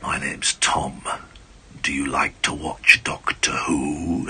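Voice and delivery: put-on a giant booming down gently to a boy; slow deep Tom Baker voice. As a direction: loom down at a small child, slowly. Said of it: "He said it in this slow, deep voice".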